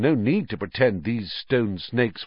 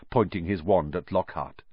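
usual speaking voice

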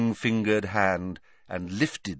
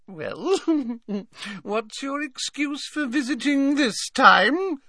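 high coy giggle and question. A narrator's reading. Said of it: "batting her lashes".

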